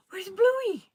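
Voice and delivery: big gasp then high loud delight; excited gasp. Real, not acted.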